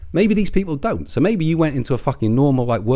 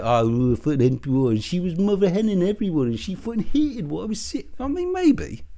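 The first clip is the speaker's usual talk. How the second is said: mocking imitation; 9 semitones higher than usual. Mimicking his respun excuse, climbing high in mockery.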